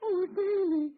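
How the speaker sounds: mother greeting her missing son, high and loud; relieved cry. Acted. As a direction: cry out his name in relief.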